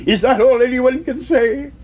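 high-pitched plaintive question; acted tearful protest. A narrator's reading. Weeping young mouse tired of no, tearful protest. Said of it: "weeping large-sized tears".

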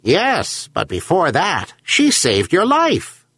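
high-pitched talking seabird voice; herring gull. A narrator's reading. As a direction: answer as a gull, pitched well up. Gull argues with old man.

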